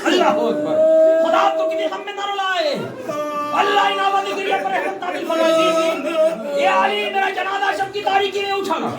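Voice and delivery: high, wailing plea; lamenting preaching. Acted. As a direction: plead at full pitch like a mourning reciter. Wails out the last testament. (ऊँचे सुर में रोती हुई वसीयत)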